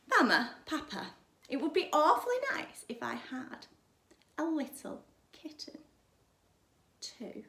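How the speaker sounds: butter them up sweetly; girl asking her parents for a kitten; wheedling little girl. A narrator's reading.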